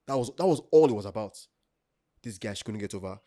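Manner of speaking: usual speaking voice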